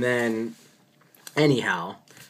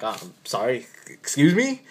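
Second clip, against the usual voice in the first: disbelieving, as if misheard; incredulous excuse me. Retold or imitated. Doctor to the officer about the dog, incredulous rise. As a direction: pitch shoots up on excuse me. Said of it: "I look at him like".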